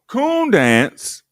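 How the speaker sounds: high, loud mock announcement of the event; mock announcer. Host mocking the old program, loud announcer call. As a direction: belt it out like a showman calling the next act.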